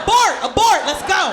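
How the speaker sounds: loud, high alarm call; urgent command. Retold or imitated. Comedian rushing cousin away from angry man, urgent shout. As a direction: shout it like calling off a mission.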